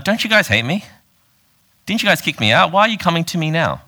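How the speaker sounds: a lightly voiced offended outcast; indignant Jephthah. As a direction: play the wronged man throwing it back at them. Preacher voices a hurt Jephthah.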